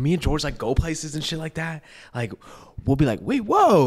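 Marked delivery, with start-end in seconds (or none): 3.2-3.9 higher, retold startled admiration; impressed double take.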